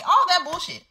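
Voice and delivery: exasperated. Real, not acted. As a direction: toss it off high and fed up. Repeats phrase, higher again.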